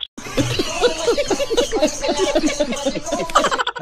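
sudden high giggle at himself; high burst of laughter. Real, not acted. Player laughing at own cowardice.

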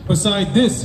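ordinary talk

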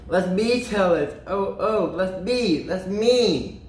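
high, drawn-out eager pleading; eager interrupters. Acted. Story characters butt in on the narrator, eager and high. Others beg to tell story.

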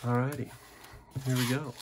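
ordinary talk, nothing marked

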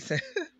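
short surprised laugh. Real, not acted. Laughs mid-sentence at card.

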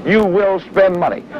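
normal talking voice

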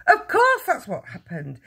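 high, exasperated cry. A narrator's reading. Mother confirms the mix-up, crying out. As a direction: cry it out impatiently. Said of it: "the mother cried".

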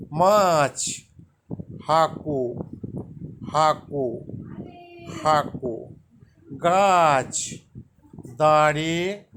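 slow call-and-repeat word drill; repetition drill. Shown as a demonstration. Teacher reviewing Santali words with students, drill-style. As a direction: lead the class through each word again. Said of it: "আবার বলছি, ভালো করে শুনে নাও".

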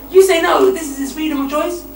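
loud, rising sermon voice; fiery preaching. Acted. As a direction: preach it hard at the crowd. Preacher at full force.

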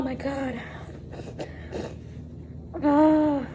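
normal talking voice

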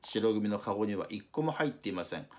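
ordinary delivery

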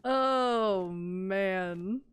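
ordinary talk, nothing marked

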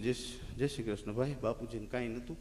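quiet sombre greeting; hushed condolence. Retold or imitated. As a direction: speak low at a funeral.

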